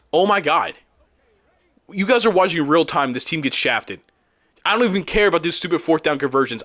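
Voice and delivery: ordinary talk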